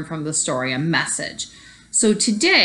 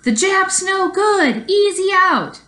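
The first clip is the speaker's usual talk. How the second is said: jeering crowd scream; 12 semitones higher, 3 dB louder, slower than usual. Acted. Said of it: "The crowd was screaming".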